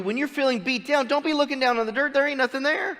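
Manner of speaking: animated, high-pitched Southern preaching; Southern accent. Real, not acted. Preacher urging the downcast to look up, Southern accent. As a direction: slip into a quick Southern twang. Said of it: "I don't know why I went southern".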